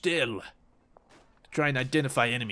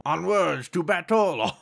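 mock battle cry; 4 semitones higher, 3 dB louder, slower than usual.

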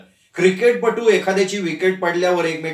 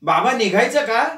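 calling out; 5 semitones higher than usual. Acted. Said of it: "नितीनची हाक ऐकू आली".

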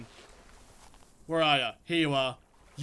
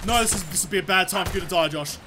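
ordinary delivery

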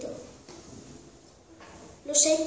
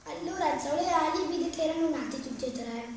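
ordinary delivery

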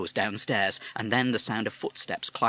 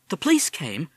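put-on young boy; 8 semitones higher, 5 dB louder than usual. Boy asks.

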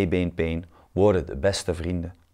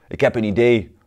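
calling out; 4 semitones higher, 6 dB louder than usual. Acted. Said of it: "roept Pieter Peer".

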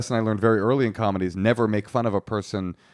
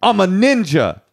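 loud, high, drawn-out attempt at a South African accent; mock South African accent; 9 semitones higher, 6 dB louder, slower than usual. Shown as a demonstration. Host takes a turn at the accent, loud high mock South African. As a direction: belt out a silly South African accent slowly. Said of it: "Now it's my turn".

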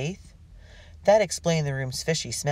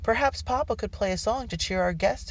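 lightly voiced higher daughter; higher-pitched polite suggestion; tentative suggestion; 8 semitones higher than usual. A narrator's reading. Hope suggests music to cheer the dinner guests, higher polite suggestion. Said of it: "Hope sh-, suggested".

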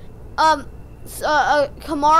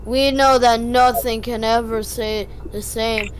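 narrator voice; 5 semitones lower, slower than usual. Acted. Kid narrating the game's rhyme to family, slow low narrator voice. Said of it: "I'm doing the voice".

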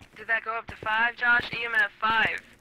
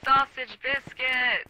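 put-on high, drawn-out kid's yell; screaming little kid; 5 semitones higher, 5 dB louder, much slower than usual. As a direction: mimic a child bawling it out slowly. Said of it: "a little kid at the freezer section screaming".

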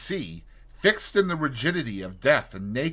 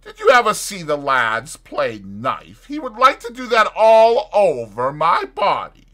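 put-on drunken taunt; 5 semitones higher, 10 dB louder than usual. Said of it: "remarked the stranger".

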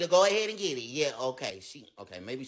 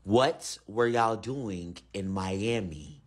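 very slow, deep alien voice; deep slow menacing repeat; slower alien repeat; much slower than usual. Acted. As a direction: drag out the question, deep and slow.

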